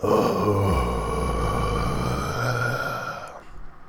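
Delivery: a long, low, exasperated sigh out; long weary sigh. Real, not acted. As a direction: let out a slow, low, tired sigh. Long sigh after reading quote.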